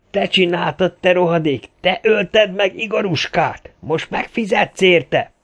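high, raging cry; slow down into a drawn-out threat; furious accusation. A narrator's reading. Leaps at girl, accusing her. (magas hangú, dühödt vádaskodás)

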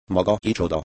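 usual speaking voice

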